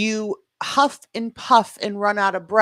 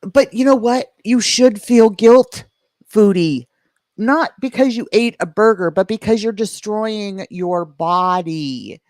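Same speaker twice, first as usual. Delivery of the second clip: scolding; 3 semitones higher, 8 dB louder than usual. Real, not acted. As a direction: lecture her loudly. Scolding loudly.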